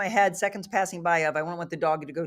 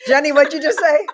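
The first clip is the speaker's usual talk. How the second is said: laughing; 8 semitones higher, 9 dB louder than usual. Real, not acted. Laughing while calling out Jenny.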